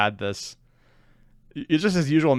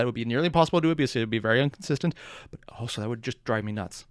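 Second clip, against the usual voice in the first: ordinary talk, nothing marked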